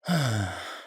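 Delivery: falling, regretful sigh; voiced sigh. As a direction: let out a sigh that sinks in pitch. Character sighs before recalling the past.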